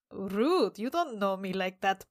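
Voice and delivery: normal talking voice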